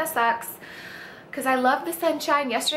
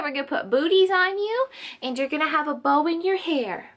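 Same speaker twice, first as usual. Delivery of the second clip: ordinary talk, nothing marked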